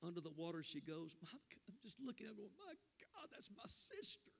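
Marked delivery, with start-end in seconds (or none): 2.6-4.3 high, slow near-whisper of awe; awed. Retold or imitated. Preacher marveling at his sister going under, awed hush. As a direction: breathe it out in wonder.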